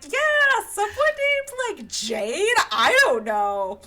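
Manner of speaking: a cousin who can't recall the name, hammed-up high and dim; ditzy high voice. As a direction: squeak it out like a clueless gossip. Fitzwilliam fumbling the name.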